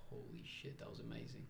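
hushed, whispered awe; very soft, almost voiceless amazement; inner amazement. Retold or imitated. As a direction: whisper it like a stunned private thought. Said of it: "inside I'm just like".

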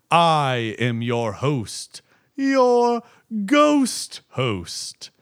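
slow theatrical villain menace; slow, drawn-out haunted house narration; slow spooky ghost host. Retold or imitated. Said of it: "the monologue from the Haunted Mansion ride".

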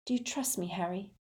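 put-on light, urgent whispering girl. A narrator's reading. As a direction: ask it secretly, under your breath. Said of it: "Hermione whispered".

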